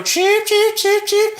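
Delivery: very high cheeping; chicken taunt. Retold or imitated. Co-host imitates everyone calling the psychiatrist a chicken, high cheeping. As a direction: squeal out evenly repeated mock cheeps. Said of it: "So they all go".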